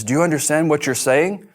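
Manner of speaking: the agent jolted by what he just heard; retold shocked agent. Agent shocked by his confession.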